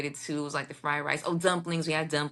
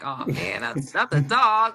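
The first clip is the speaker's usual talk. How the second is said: mock outcry; 3 semitones higher, 6 dB louder than usual. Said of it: "So it's like".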